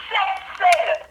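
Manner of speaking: loud, high, thrilled counting; excited shout. Real, not acted. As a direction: yell out the count in disbelief.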